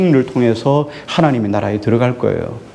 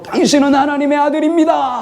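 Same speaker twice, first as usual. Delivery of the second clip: demon crying out; 13 semitones higher than usual. Retold or imitated. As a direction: call it out high so everyone hears. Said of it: "하고 큰 소리를 쳤다는 거예요".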